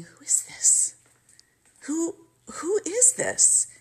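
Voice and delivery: hushed, then a rising puzzled who is this; baffled inner voice, slow and wondering; the daughter wondering who the caller is; whispered confusion. Retold or imitated. Confused thought at stranger's call.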